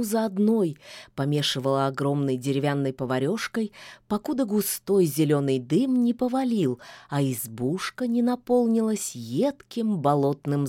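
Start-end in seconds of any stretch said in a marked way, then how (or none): none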